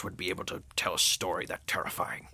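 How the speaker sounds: hushed whisper. Acted. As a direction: breathe it like a secret. Whispered aside about scary story.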